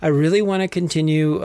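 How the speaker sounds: usual speaking voice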